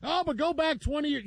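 high-pitched fan's objection; mock protest. Mimicking SEC fans' objection.

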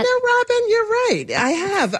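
high-pitched, excited admission; delighted agreement. Real, not acted. Chef admits to host her love of fennel, delighted and high-pitched.

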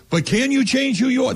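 high, insistent challenge; pressing question. Retold or imitated. Host asking the coach the key question, high and insistent. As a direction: drive the question home.

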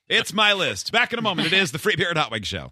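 high, loud radio host sign-off, sung out at the end; radio show sign-off. Acted. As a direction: pump up the station ID like a DJ.

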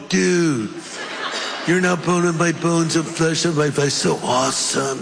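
exaggerated California dude; laid-back Californian surfer talk; California accent. Preacher imagining Adam as a Californian, exaggerated California accent. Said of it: "Or California".

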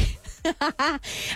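soft chuckling laugh. Real, not acted. Streamer reading Charlie Boy's message, light real laugh. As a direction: chuckle at a teasing comment.

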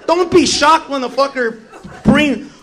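ordinary talk

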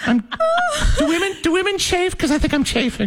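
put-on high girly voice, laughing through it; Miss America speech. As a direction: pageant queen complaining about the swimsuit. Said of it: "you gotta sound like a girl".

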